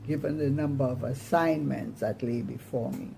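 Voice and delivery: ordinary delivery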